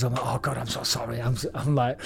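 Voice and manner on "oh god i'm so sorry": soft, sheepish apology; hushed sorry to the doctors; retold apology. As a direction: apologize meekly, much softer. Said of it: "apologizing to all the doctors".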